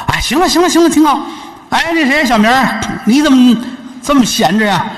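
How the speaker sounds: put-on high, needling young woman's voice; sharp young wife. Wife mocking the visitor in front of her husband, high and sharp.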